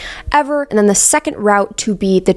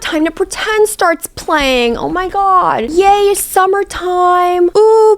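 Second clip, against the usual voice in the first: much higher, slow, squealing delight; giddy gushing; 11 semitones higher, much slower than usual. Real, not acted. Vlogger thrilled by the song and summer scene, high squeals. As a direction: squeal over the summer montage.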